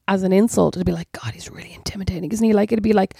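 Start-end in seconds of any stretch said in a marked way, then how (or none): 1.1-2.5 hushed, pretend gossip aside; low, whispered mock awe; mock admiring whisper. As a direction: whisper it as if secretly impressed. Imagined admiring gossip about a man.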